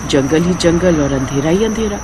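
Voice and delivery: usual speaking voice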